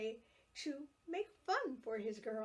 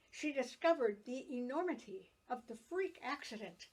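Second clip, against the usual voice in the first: ordinary delivery, nothing marked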